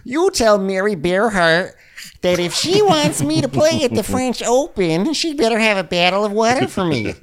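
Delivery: exaggerated high-voiced tantrum; mock diva demand. Joins bit as demanding tennis player.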